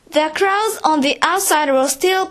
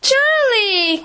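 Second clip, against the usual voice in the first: much higher, joyful cry of the name; delighted cry; 8 semitones higher than usual. A narrator's reading. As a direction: greet him with bursting glee. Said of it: "cried Mister Wonka".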